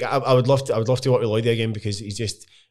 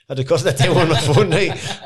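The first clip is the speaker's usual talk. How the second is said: laughing; 5 semitones higher, 7 dB louder than usual. Real, not acted. Laughing retelling of phone demo.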